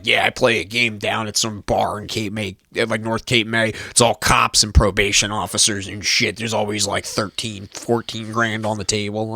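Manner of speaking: a hint of a smug old boomer; arrogant boomer. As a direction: do a mild know-it-all boomer. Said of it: "arrogant boomer, like".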